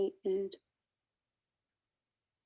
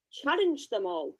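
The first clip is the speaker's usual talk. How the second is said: ordinary talk, nothing marked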